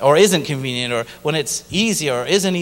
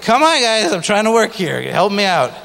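exasperated; 6 semitones higher, 5 dB louder than usual. Retold or imitated. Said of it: "I was like".

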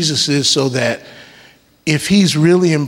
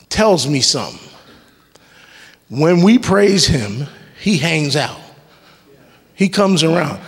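ordinary talk

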